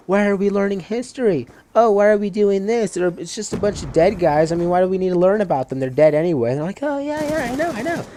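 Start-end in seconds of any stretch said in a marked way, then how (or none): 0.1-6.5 high-pitched mock whining about schoolwork; mocking whiny complaint. Mimicking classmates who complain about history. 6.8-8.0 very high-pitched mocking chorus of agreement; squeaky imitation of classmates chiming in. Mimicking classmates eagerly agreeing.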